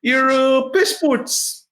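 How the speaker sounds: high-pitched, hesitant shakedown voice; shady guard. Acted. Guard stopping travelers at the gate, high put-on voice.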